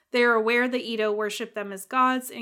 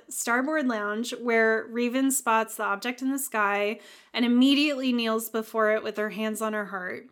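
ordinary talk

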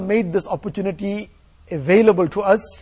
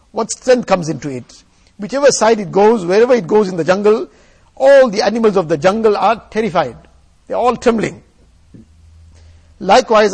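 preaching at full force; 6 dB louder than usual. Acted. Forceful sermon exhortation.

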